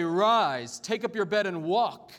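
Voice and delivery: forceful, ringing quoted command; loud command. Acted. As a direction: proclaim it loudly as a challenge. Jesus' hypothetical command, read aloud.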